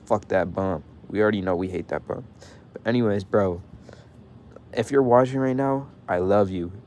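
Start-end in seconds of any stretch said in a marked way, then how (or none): none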